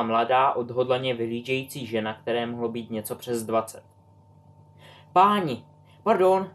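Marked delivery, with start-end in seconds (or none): 5.1-6.5 high quick oops, sorry; startled oops. A narrator's reading. Girl blurts an apology after scaring Albus, high and quick. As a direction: caught off guard, blurting it.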